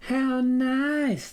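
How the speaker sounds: high, bright chime of a wife; chiming in. Acted. Farmer's wife to the stranger, high sweet chime. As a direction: sing it out sweetly. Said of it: "the farmer's wife chimed in".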